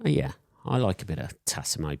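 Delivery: ordinary talk, nothing marked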